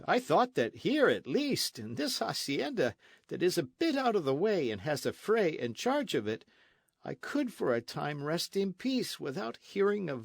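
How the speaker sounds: lightly voiced weary dandy; foppish Don Diego. A narrator's reading. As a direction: play the idle poet-loving nobleman. Don Diego's languid complaint.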